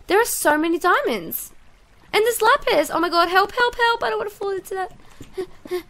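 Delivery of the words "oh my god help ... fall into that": panicked cry for help. Real, not acted. Nearly falling into lava.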